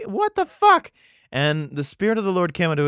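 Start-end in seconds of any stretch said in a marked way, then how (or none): 0.0-0.9 much higher, loud cry of disbelief; shocked outburst. Real, not acted. Reading the Bible aloud and reacting, a shocked loud outburst. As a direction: blurt it out, totally stunned.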